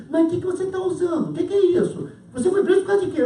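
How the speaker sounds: high-pitched baffled interrogation; incredulous questioning. Acted. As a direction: press him in comic disbelief. Incredulous cellmate interrogates him.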